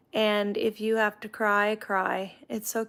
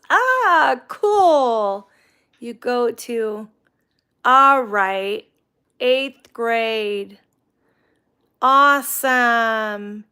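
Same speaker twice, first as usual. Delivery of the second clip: excited; 10 dB louder, much slower than usual. Real, not acted. Reacting to a viewer's news.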